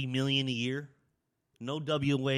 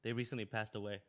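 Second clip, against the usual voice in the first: quiet, choked up; holding back grief; emotional; 5 semitones lower, 13 dB quieter than usual. Real, not acted. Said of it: "I got a little emotional".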